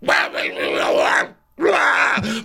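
high, slow, strained mock-drunk voice; tipsy Vicky voice. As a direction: ham up a giddy drunk woman. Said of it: "So she's like".

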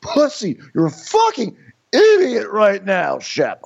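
high, drawn-out insults; mock Austin tirade. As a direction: berate him in a hammed-up voice, stretching the swear words. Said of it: "He's like".

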